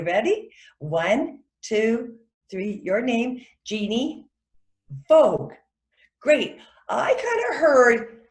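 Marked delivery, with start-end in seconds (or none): 3.6-5.5 Jeannie lifted, a pause, then Vogue loud and falling; pause between names. Shown as a demonstration. Coach counting students into saying names, clearly separated. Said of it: "say your name and then do this".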